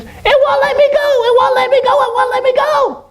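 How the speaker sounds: very high squeaky monkey voice; frantic falsetto monkey cries for help; panicked monkey. Acted. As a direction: squeal in alarm as a trapped little monkey. Voices trapped monkey in a story.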